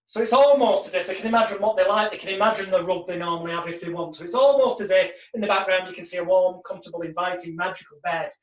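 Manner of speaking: ordinary talk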